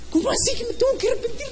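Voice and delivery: high, quick, baffled protest; acted high-pitched questioning; baffled listener. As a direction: play an incredulous listener in a squeaky-high rush. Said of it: "Yo me imagino que cuando él les decía".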